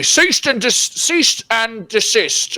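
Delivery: high, put-on guard voice; drawn-out mock official command; guards' order. Game master voicing guards ordering a halt, mock command. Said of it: "they say".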